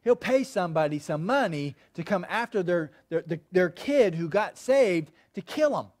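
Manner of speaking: usual speaking voice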